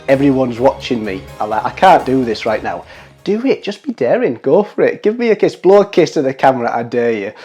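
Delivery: ordinary talk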